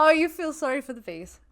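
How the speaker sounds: a sneering parrot of the guest; sarcastic mock sympathy. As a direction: throw his words back at him, mocking. Mocking the guest's bee claim.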